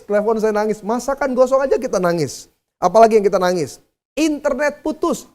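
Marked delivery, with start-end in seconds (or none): none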